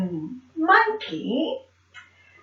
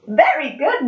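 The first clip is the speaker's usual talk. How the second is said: ordinary delivery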